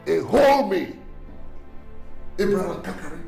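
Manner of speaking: loud, high indignant exclamation; outraged disbelief. Acted. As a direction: take it as an insult, raise your voice. Husband outraged at adoption suggestion.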